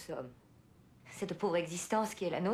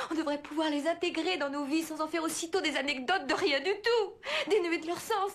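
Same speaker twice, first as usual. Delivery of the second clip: tearful outburst; 6 semitones higher than usual. Acted. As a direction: voice rising, cracking toward tears. Emotional outburst, near tears.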